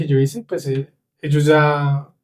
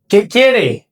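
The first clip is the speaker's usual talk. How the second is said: mock impatient shopkeeper, louder and higher; raised voice of an impatient Russian clerk; 7 semitones higher, 4 dB louder than usual. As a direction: snap the question like an irritated clerk. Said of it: "Entonces levantaba la voz".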